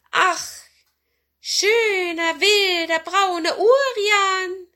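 high, loud, drawn-out cat voice; high-pitched pleading cat; flattering cat. Acted. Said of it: "sagte die Katze".

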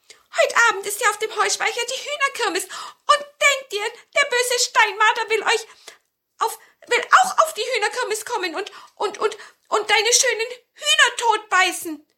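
high, loud dwarf voice, stammering and near tears; a tiny dwarf blurting out bad news in a panic; frightened little dwarf. A narrator's reading. Dwarf warns grandmother about the marten, high and tearful. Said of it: "sagte der Zwerg".

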